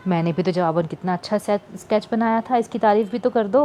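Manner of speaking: ordinary delivery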